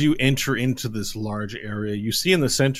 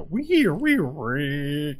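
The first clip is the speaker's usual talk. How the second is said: high squeaky radio tuning noise; high electronic wee sounds; radio whine; 6 semitones higher, much slower than usual. Acted.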